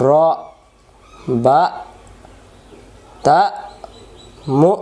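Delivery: র, বা, তা, মু each punched and cut short; hard hamza stops. Shown as a demonstration. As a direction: fire out র, বা, তা, মু loud, separated, stopped abruptly. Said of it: "আওয়াজ শক্ত করে". (র, বা, তা, মু শক্ত আওয়াজে)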